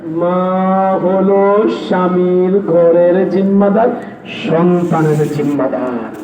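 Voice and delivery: low, slow grave delivery; solemn hadith. Acted. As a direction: weigh each word for the mothers. (মায়েদের উদ্দেশে গম্ভীর ধীর কণ্ঠে)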